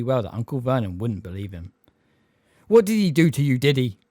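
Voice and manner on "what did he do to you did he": quivering voice. A narrator's reading. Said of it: "said in a quivering voice".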